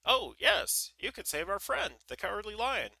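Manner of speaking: high, loud Scarecrow blurting it out; quick reply. A narrator's reading. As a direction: jump in with a high-pitched, eager answer. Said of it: "said quickly".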